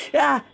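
short, high, loud yelp; startled scream. Real, not acted. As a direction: yelp from a jump scare. (tili sa gulat)

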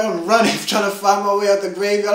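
ordinary talk

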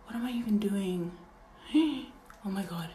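hushed whisper to herself. Real, not acted. Vlogger whispers about herself mid-piercing. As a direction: whisper it as an aside.

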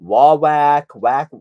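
WAWAK said loud as "waw-WACK", rhyming with back; alternate pronunciation. Shown as a demonstration. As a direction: end Wawak on a short-a "wack". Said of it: "some people pronounce it".

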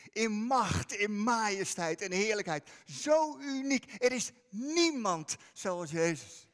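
loud, slow, emphatic preaching; preaching at full force. Acted. Preacher telling the church no one is like Jesus, loud proclamation. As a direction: proclaim it with full conviction to the congregation.

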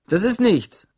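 usual speaking voice

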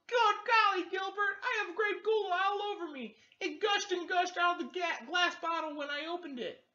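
very high, loud squeaky goat cry; yelling goat voice. Acted. Gary Goat yelling to Gilbert about the gola, high squeaky voice. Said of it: "Gary Goat yelled".

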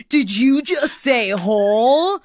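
slow, mock-shocked question; mocking incredulous. Friends teasing Olivia, slow mock-shocked question. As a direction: act scandalized and amused. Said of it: "we're like".

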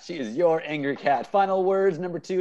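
ordinary delivery

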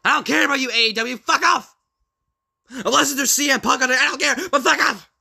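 high, screamed tirade; loud, high-pitched angry yelling; furious outburst. Acted. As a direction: scream them away at full force. Angry outburst at imagined rival, then apologizes.

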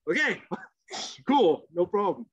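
laughing okay. Retold or imitated. Laughing reply to coach.

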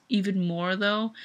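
usual speaking voice

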